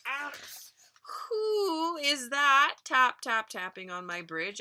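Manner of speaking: troll calling up at the middle goat; troll roar. Acted. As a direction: drag out the who like a howl. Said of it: "asks Troll".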